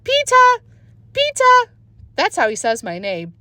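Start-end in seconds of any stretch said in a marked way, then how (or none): 0.1-1.7 put-on high childish call; toddler calling. A narrator's reading. Toddler Fudge calls his brother from the jungle gym, high and loud. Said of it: "until he called".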